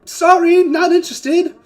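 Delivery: loud, high holler; calling out. Acted. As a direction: shout it through to visitors. Giant calls answer to visitors.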